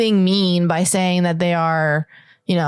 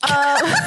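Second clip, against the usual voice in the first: evil witch laugh. Real, not acted. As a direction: cackle like a wicked witch. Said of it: "That was evil, evil witch laugh".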